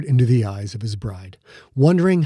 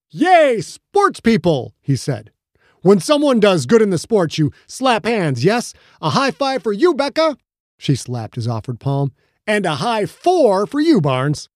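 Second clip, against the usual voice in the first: excited cheer; 8 semitones higher than usual. Acted. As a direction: cheer like a clueless alien fan. Fred cheering the newlyweds.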